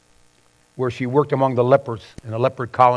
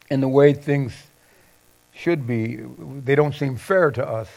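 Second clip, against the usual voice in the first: normal talking voice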